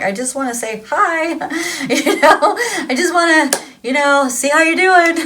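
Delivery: high, giggly protest; mock pleading. Reader replaying reaching out to someone who runs, mock pleading. Acting out own plea, giggling.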